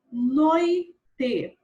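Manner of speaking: "Noite" loud, with a long "noi"; syllable by syllable. Shown as a demonstration. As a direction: draw it out as noi... te. Teacher splits word into syllables. ("Noite" em duas sílabas, forte e alongada)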